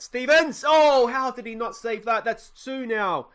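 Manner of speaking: anguished outburst at a missed save; dismayed shout. Real, not acted. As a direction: yell the keeper's name as the ball goes past him. Keeper misses penalty save.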